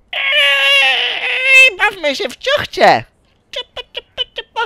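high excited yell; excited shout. Acted. Character calling people to play train, high excited yell.

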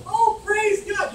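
high, slow, hammed-up shout of praise; mock joyful praise. Preacher mocking a fake response to God's correction, hammed-up high praise. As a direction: overdo it like a fake saint. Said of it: "don't go".

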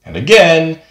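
ordinary talk

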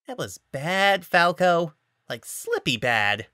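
a drunk mocking his friend; drunk teasing. A narrator's reading. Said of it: "Panther mocking Falco".

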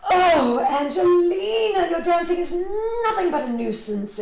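high, loud wail of exasperation, easing at the end; exclaiming. A narrator's reading. Mother scolding her daughter over the spilt milk, exclaiming. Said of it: "exclaimed her mother".

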